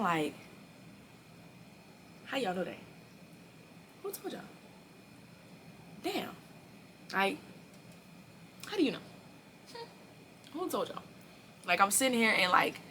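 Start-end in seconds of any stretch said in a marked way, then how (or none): 2.3-11.0 small, high-pitched bewildered questions; baffled. Retold or imitated. Storyteller wondering who told everybody, high and baffled. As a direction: squeak out short puzzled questions with long pauses. Acted baffled reaction to gossip.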